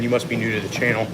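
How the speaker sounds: ordinary talk, nothing marked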